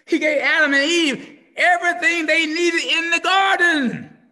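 ordinary talk

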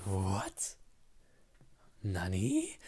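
drawn-out, disbelieving question; mock shock. Speaker reacting to friends' compliment, playfully shocked. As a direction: stretch out the what in playful disbelief. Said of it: "I'm legitimately shocked".

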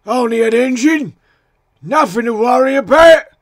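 loud intruder brushing it off; intruder character voice. A narrator's reading. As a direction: call it out high and loud. Intruder exclaims on spotting engine.

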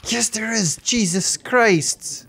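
high, drawn-out dismay; startled exclamation. Real, not acted. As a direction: cry out on finding another trap. Hit by another trap.

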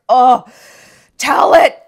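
luide aanmoediging; cheering. Retold or imitated. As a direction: roep het als een fan in de zaal. Cheering the speech.

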